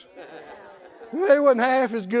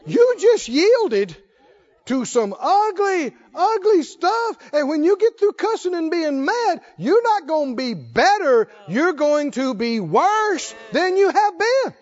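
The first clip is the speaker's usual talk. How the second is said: raised, hard-hitting pulpit delivery; forceful preaching; 6 semitones higher, 4 dB louder, slower than usual. Real, not acted. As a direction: drive the warning home to the congregation.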